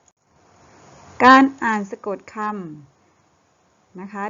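a teacher slowly spelling out การอ่านสะกดคำ loud for pupils; slow, loud read. Shown as a demonstration. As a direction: drag out การอ่านสะกดคำ clearly for the class.

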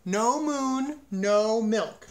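high, loud bellow with drawn-out vowels. A narrator's reading. Cow gives her farmer an ultimatum, bellowing. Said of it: "she bellowed".

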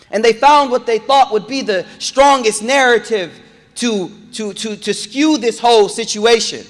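high, loud impassioned sermon; preaching at full force. Acted. Preacher rousing the congregation, high and loud. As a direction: drive it home to the crowd.

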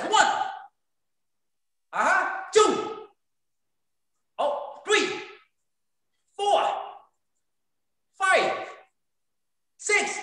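high, called-out count; drill count. Real, not acted. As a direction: call each rep like a coach. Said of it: "instructor counting out drill reps".

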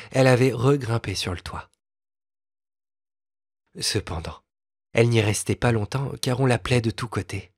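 ordinary delivery

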